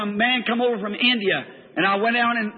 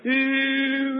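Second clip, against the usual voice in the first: drawn-out wail; 3 semitones higher than usual. Retold or imitated. Preacher imitates the man he cast Satan out of, drawn-out wail. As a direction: imitate a man's drawn-out cry. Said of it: "He went".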